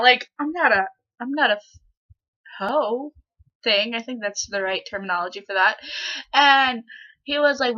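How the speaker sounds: ordinary talk, nothing marked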